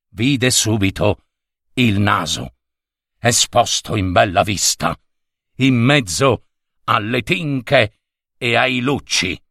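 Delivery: ordinary delivery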